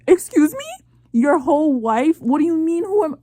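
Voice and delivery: high, loud indignant "excuse me"; real outrage at the screen; outraged disbelief. Real, not acted. Outraged at husband in drama.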